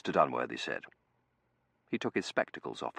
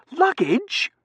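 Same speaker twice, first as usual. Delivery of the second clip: very high, loud squawked question; outraged disbelief; 13 dB louder than usual. Acted. Seraphim balking at collecting luggage, outraged disbelief. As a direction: throw it back, appalled and rising.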